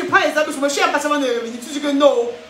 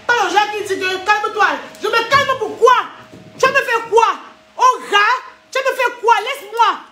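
put-on aggressive girlfriend; 4 semitones higher than usual. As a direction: bark back like a girl spoiling for a fight.

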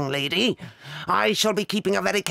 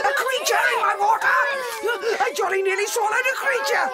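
shocked shout; 14 semitones higher, faster than usual. Acted. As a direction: shriek in disgusted alarm. Finds creature in her water.

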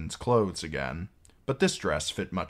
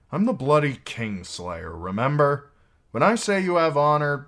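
louder, higher, scornful knight; bitter retort; 9 semitones higher, 7 dB louder than usual. A narrator's reading. Knight rejecting the maid's talk of honor, bitter. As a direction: throw the praise back forcefully.

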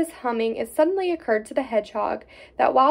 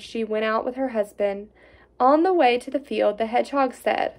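plain reading voice

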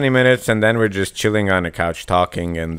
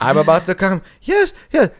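higher-pitched pretend ecstasy, jumping up on the yeses; mock climax; 7 semitones higher than usual. Joking with friends about silly bedroom talk, mock climax. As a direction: play a hammed-up climax with a laugh in it.

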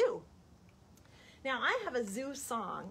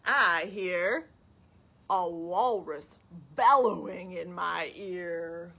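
put-on reading to children; 5 dB louder, slower than usual. Storytime question and answer about a walrus, lilting. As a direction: chant the question, space out the answer. Reads picture book to children.

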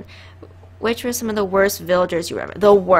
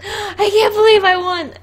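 high excited disbelief; 11 semitones higher, 5 dB louder than usual. Real, not acted. Disbelief at winning.

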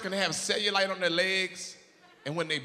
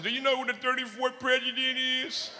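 put-on high childlike voice; 9 semitones higher than usual. Voicing girlfriend.

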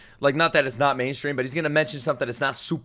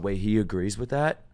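normal talking voice

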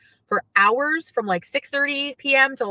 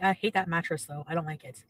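ordinary talk

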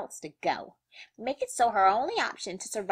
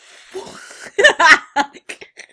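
loud burst of laughter; 9 semitones higher, 15 dB louder than usual. Real, not acted.

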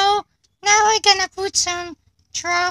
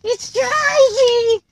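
excited, slow; 3 semitones higher, slower than usual. Real, not acted. Car drives.

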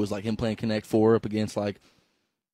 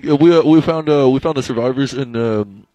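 loud, halting mock soldier radio call; radio report; 10 dB louder than usual. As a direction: report loudly into a walkie-talkie. Pretend soldier reporting over radio.

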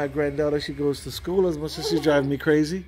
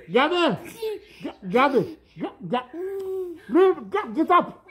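exasperated calls; 10 semitones higher than usual. Real, not acted. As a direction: yell her name to get her off you. Telling granddaughter to get off.

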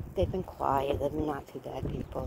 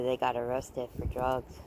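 usual speaking voice

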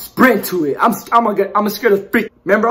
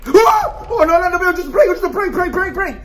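high, frantic pleading after a yell; panic, words tumbling out in repeats; panicked; 11 semitones higher, 3 dB louder than usual. Real, not acted. Player about to die in the game, panicked cries.

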